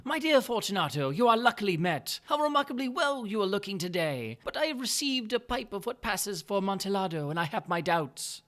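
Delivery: butter him up with mock delight; Montresor's sly greeting.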